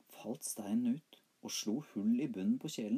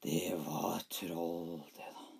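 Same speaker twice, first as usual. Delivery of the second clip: slow low troll voice; 4 semitones lower, much slower than usual. A narrator's reading. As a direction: growl it slowly like a troll. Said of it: "sa haugkjerringa".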